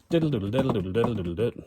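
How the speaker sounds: fast vocal drum pattern; drum rhythm scat. Shown as a demonstration. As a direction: scat the snare and kick fast. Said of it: "if I go snare, snare, kick".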